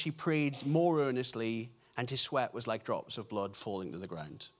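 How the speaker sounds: low, hushed-down gospel reading; scripture reading. Acted. Preacher reads the Gethsemane account to the congregation, low solemn reading.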